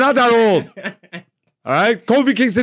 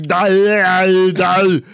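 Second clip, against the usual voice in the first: garbled, drawn-out giant voice, louder; Andre the Giant; 5 semitones higher, 6 dB louder, slower than usual. Acted. Podcaster mimicking giant wrestler's speech, garbled and drawn out. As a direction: slur the words into mush like a giant. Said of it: "he sounded like".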